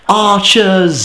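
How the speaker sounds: high, drawn-out cry of warning; mock alarm call. Gamer warns his friend of archers, high drawn-out call.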